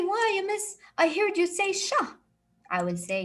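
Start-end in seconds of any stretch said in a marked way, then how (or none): 0.0-2.0 imitating a student. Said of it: "You will say".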